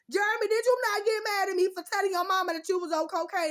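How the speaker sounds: loud, high accusing question; angry confrontation. Real, not acted. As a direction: demand an answer, shouting. Confronting ex directly, yelling.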